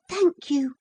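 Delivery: usual speaking voice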